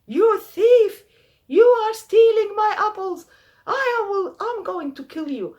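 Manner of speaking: furious high-pitched yelling at a thief; angry shout. Acted. Farmer catches thief with gun.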